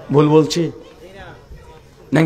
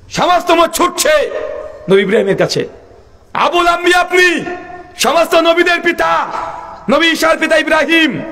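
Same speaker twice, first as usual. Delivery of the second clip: full-force preaching; 11 semitones higher than usual. Real, not acted. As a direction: preach it in a high, sustained chant-like cry. Preacher's high sustained proclamation.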